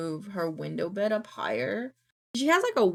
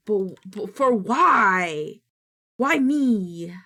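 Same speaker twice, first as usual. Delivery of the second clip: slow, fake crying whine; mock wail; 5 dB louder, much slower than usual. Retelling dogs sneezing on her, mock crying why me. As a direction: whine like a wronged child. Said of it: "and I was like".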